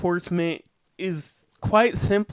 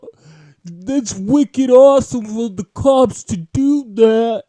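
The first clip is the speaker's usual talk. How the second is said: loud, hammed-up Boston conservative; higher, booming Boston caricature, cheering on the cops; Boston accent; 4 semitones higher, 8 dB louder than usual. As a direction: shout it in a broad mock Boston accent. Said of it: "let me try my Boston accent".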